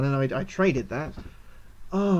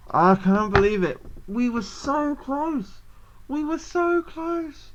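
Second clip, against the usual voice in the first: high, slow wail of disbelief; close to tears; 8 semitones higher, slower than usual. Real, not acted. Player who narrowly lost, near-tearful high wailing. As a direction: lament it, climbing higher and more drawn out. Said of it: "I actually feel like I could cry".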